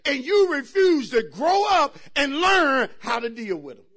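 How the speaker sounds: loud, rising shouted preaching; preaching at full force. Acted. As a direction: preach it hard to the congregation. Preaching about slow leak.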